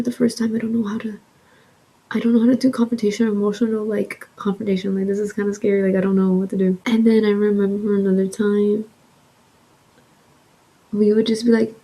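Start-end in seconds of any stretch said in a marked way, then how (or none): none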